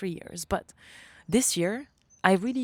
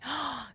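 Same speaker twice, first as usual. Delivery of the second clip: amazed gasp; 8 semitones higher, 5 dB quieter than usual. Retold or imitated. Amazed at what happened.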